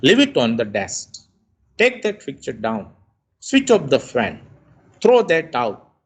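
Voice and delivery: firm orders, each falling on "desk", "down", "fan", "out"; falling command intonation. Shown as a demonstration. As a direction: let the pitch drop at the end of each order, as on "Throw that out". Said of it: "it becomes the falling intonation".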